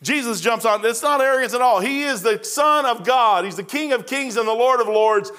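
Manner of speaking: ordinary delivery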